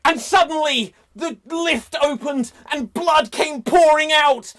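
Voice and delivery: loud, high action-movie yell; rising panicked shout; dramatic shout. Acted. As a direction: belt it out like a horror scene.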